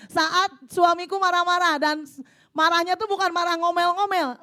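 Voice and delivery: loud, impassioned prayer voice read to the congregation; fervent prayer reading. Acted. Preacher reading a wife's prayer aloud, fervent and loud. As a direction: cry out the prayer like a battle cry. Reads the second letter.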